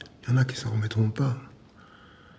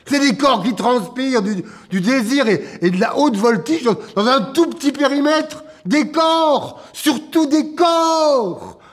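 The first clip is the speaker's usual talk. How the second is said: impassioned; 6 semitones higher than usual. Acted. Man defending live jazz as bodies, impassioned. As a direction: plead for jazz with rising fervour.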